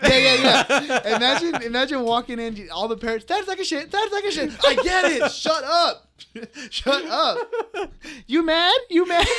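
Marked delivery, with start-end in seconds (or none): 3.3-4.5 much higher, louder squawky parrot chant; squawking parrot. Retold or imitated. Zoo parrot insulting the parents walking past, high squawky chant. 4.6-9.3 high, loud hammed-up retort; mock yelled comeback. Pretend visitor yelling back at the rude parrot, hammed-up and loud.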